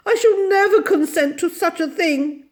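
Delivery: high, loud wailing protest; refuse, close to tears; distressed refusal. A narrator's reading. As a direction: overwrought lady's protest. Miss Lark refuses in distress.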